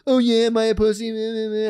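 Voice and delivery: high, whiny playground taunt; retold taunting mimicry. Storyteller imitating the rival's comeback, high and jeering. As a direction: mimic him in a mocking sing-song.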